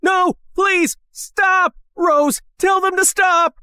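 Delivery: high, panicked plea; desperate pleading. A narrator's reading. Matthew begs Rose to stop the men, desperate.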